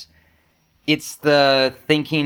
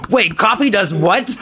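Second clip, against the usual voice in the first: shocked; 8 semitones higher, 4 dB louder, slower than usual. Retold or imitated. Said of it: "I was like".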